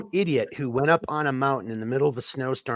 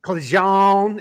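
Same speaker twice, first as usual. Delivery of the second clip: lightly attempted New Orleans accent, louder; 5 semitones higher, 7 dB louder than usual. Shown as a demonstration. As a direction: have a go at a New Orleans drawl. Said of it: "in a New Orleans accent".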